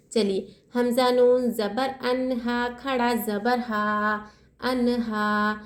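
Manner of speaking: हा stretched one alif length; teacher drilling the standing zabar; slow tajweed spelling. Shown as a demonstration. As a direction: spell out अनहा slowly, long final हा. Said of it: "एक अलिफ की मकदार खींचेंगे".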